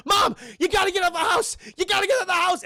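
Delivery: high, loud acted shout; urgent warning.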